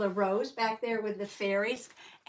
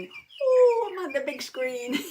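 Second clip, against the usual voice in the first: much higher and louder ooh; delighted squeal; 4 semitones higher, 7 dB louder than usual. Real, not acted. Squeal at being on big screen.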